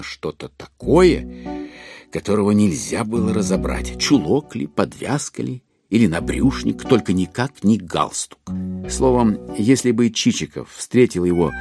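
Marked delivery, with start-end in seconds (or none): none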